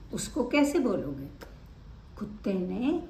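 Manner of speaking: ordinary delivery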